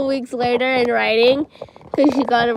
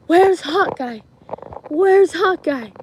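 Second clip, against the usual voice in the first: louder, higher mock searching call; imitated calling out; 5 semitones higher, 5 dB louder, slower than usual. Friend searching the commons for the new boy, imitated calling out. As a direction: call it out like hunting through a crowd. Friend leading hunt through crowd.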